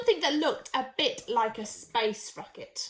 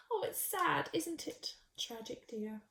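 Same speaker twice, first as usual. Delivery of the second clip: quiet sad murmur; 12 dB quieter than usual. Acted. Animals mourn Sheila.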